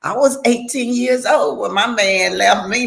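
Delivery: usual speaking voice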